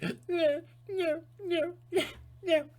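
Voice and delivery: very high whimpering no; mock frightened whimper. Mocking people refusing power, whimpering high. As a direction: cry out like a scared child.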